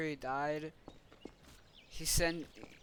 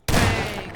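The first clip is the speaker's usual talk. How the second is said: very loud, high "bang"; explosive mock gun noise; imitated gunshot; 7 semitones higher, 16 dB louder than usual. Shooter firing his gun, imitated gunshot.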